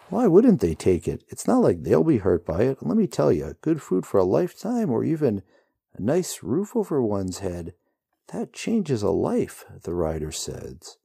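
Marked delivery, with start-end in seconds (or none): 0.1-9.6 higher, louder, offhand rider voice; breezy rider voice. A narrator's reading. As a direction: shrug off horror lightly. Rider's voice in story.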